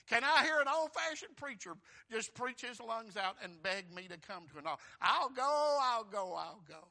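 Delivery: loud, high wail easing off toward the end; impassioned preacher acting out the damned; desperate plea from hell. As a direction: cry it out as a desperate soul begging. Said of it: "people in hell right now saying".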